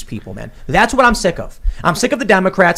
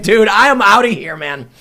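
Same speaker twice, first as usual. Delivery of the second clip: higher, louder fed-up line; fed-up exit; 6 semitones higher, 5 dB louder than usual. Retold or imitated. To the staff, a louder fed-up walkout. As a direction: announce you're leaving, fed up. Storming out of restaurant.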